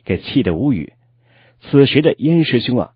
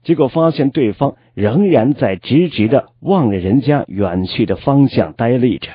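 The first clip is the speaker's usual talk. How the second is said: ordinary delivery, nothing marked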